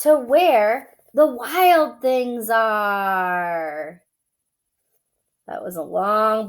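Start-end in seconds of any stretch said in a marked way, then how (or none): 0.0-4.0 story reading. A narrator's reading. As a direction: read it like a bedtime story. Reads picture book aloud.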